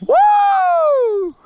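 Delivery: very high, loud whoo of joy; triumphant whoop. Real, not acted. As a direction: let out a huge victory whoop. Celebrating the catch.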